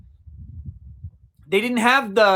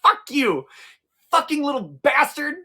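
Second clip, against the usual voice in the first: mock angry rant; 8 semitones higher, 3 dB louder than usual. Inner rant at the clerk.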